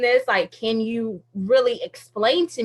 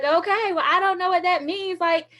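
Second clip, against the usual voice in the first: freaking out; 6 semitones higher than usual. Retold or imitated. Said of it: "completely freaking out and stressing out".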